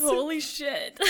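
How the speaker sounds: laughing through it; giggling out the words. Real, not acted. Cracking up.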